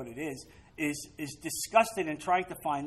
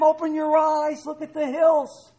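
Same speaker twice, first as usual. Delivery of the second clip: urgent cry; 10 semitones higher, 6 dB louder than usual. Acted. Prophet urging servant.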